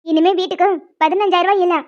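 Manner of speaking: normal talking voice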